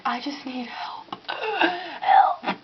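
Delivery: imitating a faint desperate plea; breathless whisper. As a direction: whisper the plea, then gasp for help.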